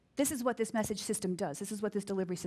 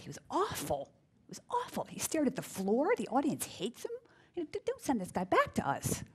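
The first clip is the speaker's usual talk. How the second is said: imitating the producer; 6 semitones higher than usual. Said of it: "And the producer said".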